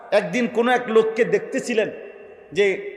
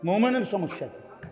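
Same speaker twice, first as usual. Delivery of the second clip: much softer rhetorical question; quiet repeat; 10 dB quieter than usual. Real, not acted. Preacher putting the question to the crowd, quiet aside. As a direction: let the question drop right down.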